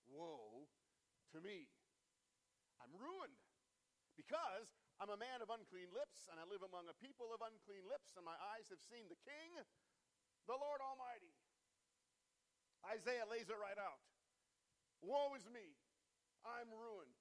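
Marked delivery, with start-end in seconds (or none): 0.1-11.3 a touch of anguish, louder, rising on ruined; Isaiah's anguished cry. Acted. Isaiah answering the vision of the Lord, lightly anguished. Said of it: "this is how Isaiah replied". 15.0-17.1 louder, higher cry of woe; Isaiah crying out. Acted. Isaiah laying out his ruin before the Lord, crying out. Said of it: "as Isaiah cries out".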